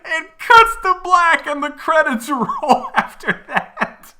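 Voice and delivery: high, loud shrieking through laughter; helpless squealing laughter while shouting; laughing hysterically. Real, not acted. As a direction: barely get it out, cracking up at top volume. Cracking up retelling the absurd ending.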